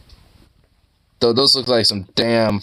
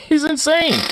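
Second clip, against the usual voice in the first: squealed laugh. Real, not acted. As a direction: shriek it out while laughing. Laughing at the dog.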